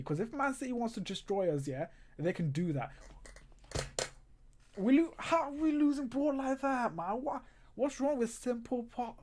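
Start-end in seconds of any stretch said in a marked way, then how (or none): 4.8-9.2 high, loud, disbelieving cry; exasperated outburst. Real, not acted. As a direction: yell at the screen in disbelief. Fan reacting to lost ball.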